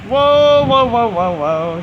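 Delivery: loud, high run of whoas dropping away; startled cry, easing off; alarmed. Real, not acted. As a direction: react to a sudden big gust. Big gust hits the trees.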